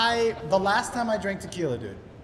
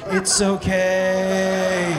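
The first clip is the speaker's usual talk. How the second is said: put-on soothing kid; 5 dB louder, much slower than usual. Kid on the ride soothing the comic, slow gentle mock reassurance. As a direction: comfort a grown man like a little kid. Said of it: "he starts caressing my shoulder".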